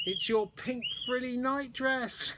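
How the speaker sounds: high, slow words through giggles; laughing. A narrator's reading. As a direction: giggle the line out. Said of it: "laughed Amber".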